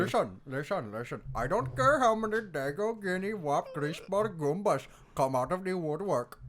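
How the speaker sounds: put-on mobster line in an Indian accent. Actor redoing a Godfather line for laughs, Indian accent. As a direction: redo the tirade with an Indian accent. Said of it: "in an Indian accent".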